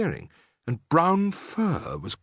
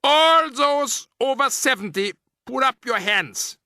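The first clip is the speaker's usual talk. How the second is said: much higher, louder, slow witch order; barking; 13 semitones higher, 5 dB louder than usual. A narrator's reading. Grand High Witch questioning the old witches, barked order. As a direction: bark the order. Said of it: "she barked suddenly".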